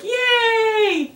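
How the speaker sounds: very high, drawn-out squeal of joy; mimicked cheer. Fan imitating a pony singing along, high-pitched cheer.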